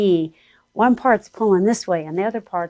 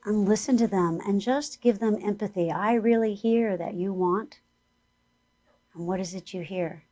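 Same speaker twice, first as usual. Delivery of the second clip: guided meditation; 3 semitones higher than usual. Acted. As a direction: lead the listeners gently inward.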